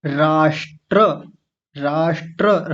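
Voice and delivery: राष्ट्र drawn out slowly; slow demo. Shown as a demonstration. Teacher repeating the word for the class. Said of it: "क्या लिखा हुआ है".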